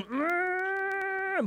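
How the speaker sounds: high soft crying hum; pastor's heart aching, soft high whimper; tender pastoral whimper. Retold or imitated. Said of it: "my pastoral heart for you is inside going".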